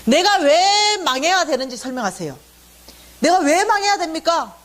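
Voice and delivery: high-pitched outburst of protest to God. Retold or imitated. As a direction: retell it as a heated, rising demand. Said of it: "터지기 시작했어". (하나님께 따져 묻듯 높게 터뜨리는 항의)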